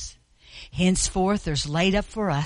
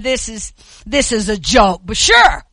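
scoffing, rising to a loud jeer; mock the challenge, louder at the end; 3 semitones higher, 8 dB louder than usual. Boastful hare voiced.

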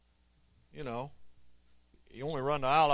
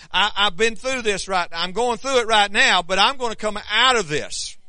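rising, louder fiery preaching; 7 semitones higher, 11 dB louder than usual. Acted. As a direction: build it up, preacher fire. Preaching at full force.

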